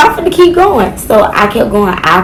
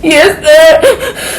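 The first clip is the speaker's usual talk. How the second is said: tearful yes sir; 7 semitones higher, 8 dB louder than usual. Retold or imitated. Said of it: "I'm like".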